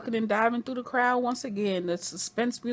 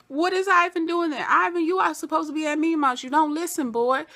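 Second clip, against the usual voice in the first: put-on higher, louder scolding of a wayward boy; a hint of a stern parent; mock scolding the boy; scolding Ivan; 7 semitones higher, 4 dB louder than usual.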